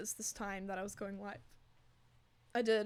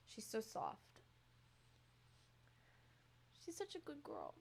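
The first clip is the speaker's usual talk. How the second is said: ordinary talk, nothing marked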